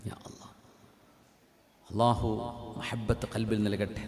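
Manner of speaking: soft, low supplication; hushed prayer. Real, not acted. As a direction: pray under your breath, pitch dropped. Short supplication after the story.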